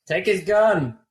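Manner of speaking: high acted shout; shouted order. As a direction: call out an urgent command. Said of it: "I think Tatty shouts".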